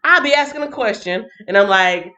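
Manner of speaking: usual speaking voice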